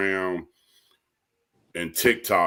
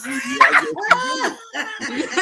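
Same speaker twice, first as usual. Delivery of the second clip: laughing; 3 dB louder, slower than usual. Retold or imitated. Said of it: "I said".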